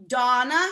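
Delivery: "Donna" with a hard, plain D sound. Shown as a demonstration. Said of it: "That's a hard D".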